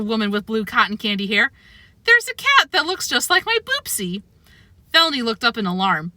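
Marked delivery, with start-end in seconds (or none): 2.0-4.2 put-on delighted old lady. A narrator's reading. Old woman delighted at cat.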